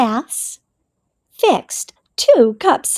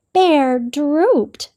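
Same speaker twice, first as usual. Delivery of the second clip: ordinary delivery, nothing marked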